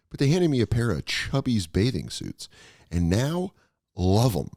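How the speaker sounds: laid-back sponsor read. Acted. Host reading the Chubbies sponsor spot, ad read. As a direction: sell the shorts casually, friendly pitch.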